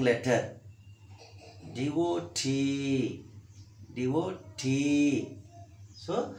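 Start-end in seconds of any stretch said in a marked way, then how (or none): 1.7-5.4 slow "D O T", long final T each time; "D O T" with weight on the stretched last T; stress on last syllable. Shown as a demonstration. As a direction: hold the T in "D O T". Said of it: "keep the S stress on the T".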